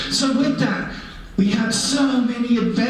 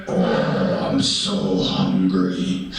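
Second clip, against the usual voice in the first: much lower, slow, moaning bear groan; hungry bear voice; much slower than usual. Acted. Storyteller voicing Brother Bear moaning, slow low hungry groan. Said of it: "and he went".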